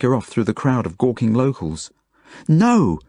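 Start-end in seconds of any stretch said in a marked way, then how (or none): 2.5-3.0 high, loud cry of refusal; defiant yell. A narrator's reading. Zelda refusing to be taken away, yelling. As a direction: yell it out in protest. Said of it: "yelled Zelda".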